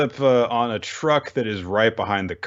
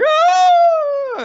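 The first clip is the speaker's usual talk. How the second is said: very high cartoon shriek; mock shriek; 8 dB louder than usual. Kobeni left on her knees, high mock shriek. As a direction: let out a squeaky scream.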